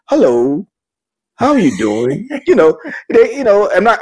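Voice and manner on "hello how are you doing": bill collector voice. Retold or imitated. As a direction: switch to a polite phone voice. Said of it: "the bill collector voice".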